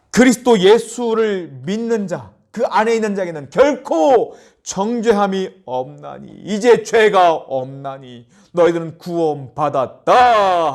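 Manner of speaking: slow, loud, high proclamation; preacher declaring salvation; fervent preaching. Acted. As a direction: preach it out slowly with force. Preaching at full force.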